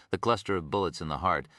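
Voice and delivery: usual speaking voice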